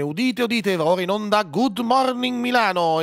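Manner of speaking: high, ringing herald call; town crier. Shown as a demonstration. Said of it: "il cantore dell'inizio della trasmissione".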